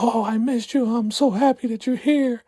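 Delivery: very high, gushing sweet voice; gushing sweetheart. Retold or imitated. Desiree greeting Cal with gushing, very high delight. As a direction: coo it like a lovestruck girl.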